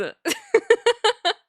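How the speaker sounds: a squeaky helpless laugh; high exasperated laugh. Real, not acted. Laughing at own frustration.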